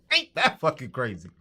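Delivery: real laughter running into the words; laughing. Real, not acted. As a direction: talk while still chuckling. Still laughing at the comeback.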